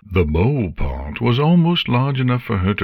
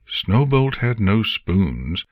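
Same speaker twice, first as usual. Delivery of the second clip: usual speaking voice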